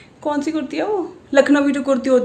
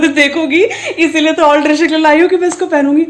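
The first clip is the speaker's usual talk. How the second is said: laughing; 5 semitones higher, 6 dB louder than usual. Retold or imitated. Laughing while quoting herself.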